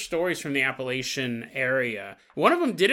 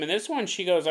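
normal talking voice

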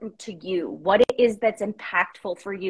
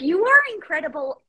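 gushing praise; 7 semitones higher, 6 dB louder, faster than usual. Retold or imitated. Retelling her gushing reply to husband.

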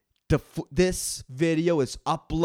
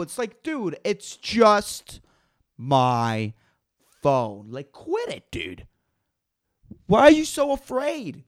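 normal talking voice

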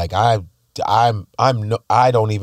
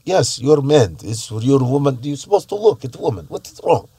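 Russian woman impression; 2 semitones higher than usual. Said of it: "when I was in Russia, they're like".

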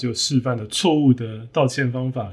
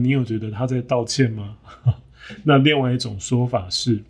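ordinary talk, nothing marked

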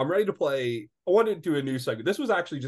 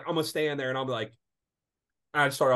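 usual speaking voice

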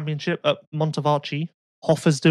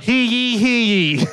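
town crier; 5 semitones higher, much slower than usual. Retold or imitated.

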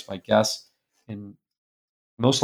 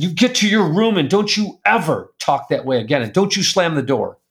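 angry yell; 5 semitones higher than usual. Retold or imitated. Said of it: "I yell like I've never yelled before".

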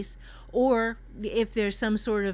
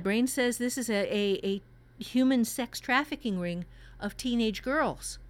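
ordinary talk, nothing marked

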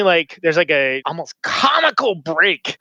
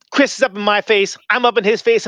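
usual speaking voice

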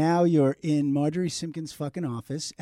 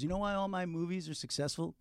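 much softer, offhand question; quiet, casual quote; retold low-key delivery; 11 dB quieter than usual. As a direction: imitate a director's understated setup to a story. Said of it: "just like this. He goes".

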